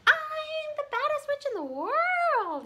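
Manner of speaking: high, loud, slow witch yell; high screeching witch boast. Acted. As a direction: shriek it like a proud little witch. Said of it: "yelled Ramona".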